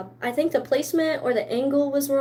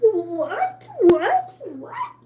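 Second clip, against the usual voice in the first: rising, much higher squeaky what; playful fake surprise, each what higher; mock disbelief; 7 semitones higher, much slower than usual. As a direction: act shocked at your own news. Mock disbelief at own Korn news.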